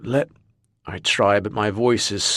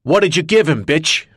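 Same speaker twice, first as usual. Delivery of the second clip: loud, higher angry roar; 7 semitones higher, 6 dB louder than usual. Acted. Mac confronting the woman, roaring. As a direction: tear into her with rage. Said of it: "Mac roars".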